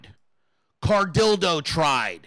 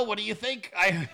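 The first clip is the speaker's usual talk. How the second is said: laughing; 5 dB quieter than usual. Real, not acted. Laughing while talking.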